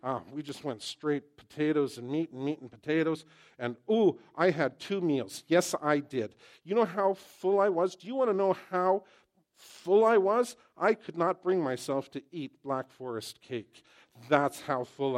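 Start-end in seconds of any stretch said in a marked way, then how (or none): 3.6-10.6 rising louder and higher, playfully showing off; excited boasting. Retold or imitated. Preacher telling congregation about the buffet, excited and louder. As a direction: build up the bragging about how stuffed you were.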